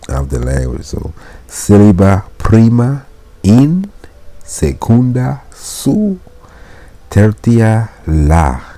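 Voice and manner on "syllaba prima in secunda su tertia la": high-pitched "in", "su", "la", each set apart by pauses; syllable-by-syllable demonstration. Shown as a demonstration. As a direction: count off "in", "su", "la" as separate, raised syllables. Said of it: "That's the three syllables".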